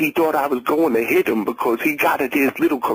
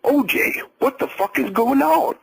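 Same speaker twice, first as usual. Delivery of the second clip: higher, alarmed "O J"; shocked demand; 8 semitones higher than usual. A narrator's reading. The ex-wife bursting out at the standoff, shocked.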